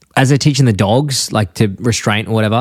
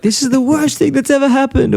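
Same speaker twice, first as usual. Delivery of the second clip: much higher mock-tragic Homer voice; high cartoon dad in mock despair; Homer melting down. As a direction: ham up the overblown despair. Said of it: "being like".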